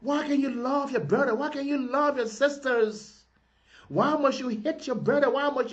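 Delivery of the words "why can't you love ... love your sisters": ordinary talk, nothing marked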